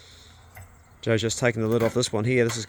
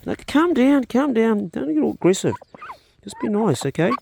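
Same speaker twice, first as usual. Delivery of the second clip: much higher and louder, urgent; calming an aggressive duck; 10 semitones higher, 5 dB louder than usual. Real, not acted. Gardener calming an aggressive Super Duck, high and loud. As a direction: soothe a pecking bird in a rush.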